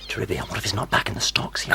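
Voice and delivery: whisper. Acted. Secret aside to lover.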